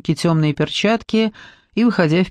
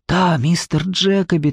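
usual speaking voice

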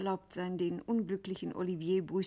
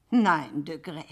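firm refusal; 2 semitones lower, 7 dB louder, slower than usual. Acted. Scuderi refuses firmly.

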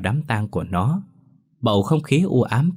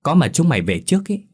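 higher, louder annoyed snap back; irritated retort; 7 semitones higher, 4 dB louder than usual. A narrator's reading. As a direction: bite back at the kids. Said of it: "Tôi bực dọc đáp lại".

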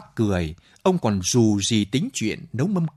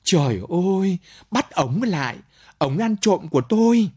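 higher, louder cry of alarm; owner shouting; 8 semitones higher, 3 dB louder than usual. A narrator's reading. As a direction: yell for someone to catch the thief. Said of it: "Chủ nhà chạy ra la lên".

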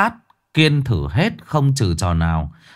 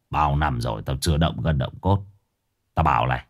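very low, softer threat; low tough-guy warning before a fight; quiet menace; 9 semitones lower, 6 dB quieter than usual. Acted.

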